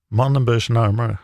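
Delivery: ordinary delivery, nothing marked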